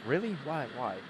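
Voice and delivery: surprised. Real, not acted. Reacts to TV turning on.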